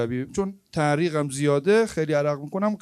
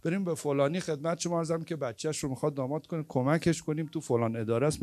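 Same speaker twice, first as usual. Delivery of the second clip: usual speaking voice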